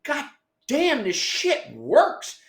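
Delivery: retold astonishment; amazed exclamation. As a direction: savour the discovery. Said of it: "And I was like".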